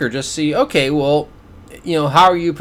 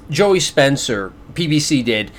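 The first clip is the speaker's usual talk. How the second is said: usual speaking voice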